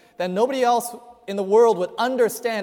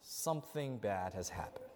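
hushed dread; 10 semitones lower, 16 dB quieter than usual. Acted. Saul realizing disaster.

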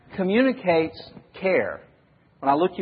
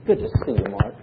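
whispered greeting in a hug; 4 semitones lower, faster than usual. Shown as a demonstration. Hugging Mark, speaking close.

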